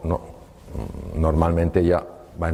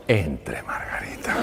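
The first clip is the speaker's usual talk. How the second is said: hushed and much softer, breathy whisper; 6 semitones lower, 5 dB quieter, slower than usual. Retold or imitated. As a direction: whisper her in like a secret. Doctor hushes patient in.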